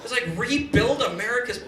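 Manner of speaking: usual speaking voice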